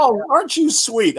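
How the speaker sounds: gushing reply. Retold or imitated. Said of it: "And I'm like".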